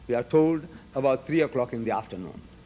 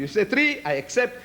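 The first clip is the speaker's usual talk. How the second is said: agreeable, accommodating; 7 semitones higher than usual. Retold or imitated. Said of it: "I say".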